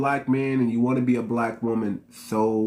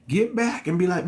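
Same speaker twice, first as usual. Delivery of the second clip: normal talking voice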